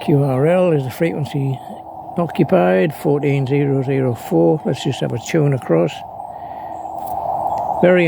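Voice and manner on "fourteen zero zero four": ordinary talk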